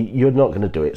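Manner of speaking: normal talking voice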